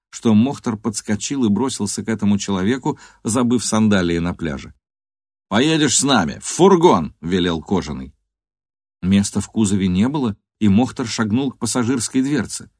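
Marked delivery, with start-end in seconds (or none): 5.5-7.2 rising into a loud order; commanding shout. A narrator's reading. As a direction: order him in, getting louder. Thug orders him in.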